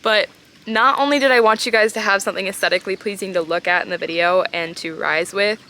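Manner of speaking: ordinary talk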